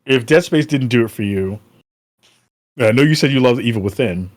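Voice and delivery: ordinary delivery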